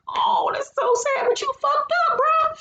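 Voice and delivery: very high, squeaky mock sob; mock crying. Reacting to Trick's heartbreak, mock-tearful sympathy. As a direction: whine it like a fake cry.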